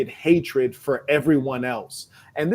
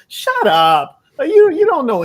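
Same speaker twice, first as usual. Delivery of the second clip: exasperated; 11 semitones higher, 7 dB louder than usual. Real, not acted. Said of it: "dismissing the heritage claims".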